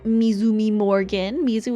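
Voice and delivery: ordinary talk, nothing marked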